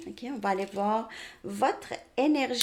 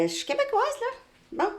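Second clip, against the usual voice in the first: high-pitched Québécois twang, owning it; playful Québécois accent. Shown as a demonstration. Québécoise owning her accent to viewers, playful high Québécois voice. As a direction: lean into your Québec accent, pitched up. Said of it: "mon accent, c'est ça".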